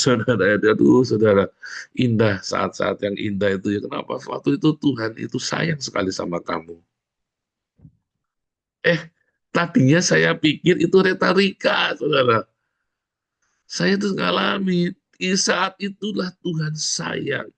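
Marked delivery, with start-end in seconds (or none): none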